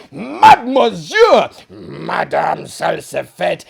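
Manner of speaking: loud, shocked shout of dinner guests; alarmed cry. Acted. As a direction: cry out to stop her. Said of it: "cried a dozen voices".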